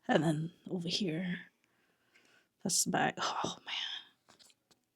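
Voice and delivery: breathless whisper of amazement; awed whisper. Real, not acted. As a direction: whisper it in disbelief. Admiring the back cover.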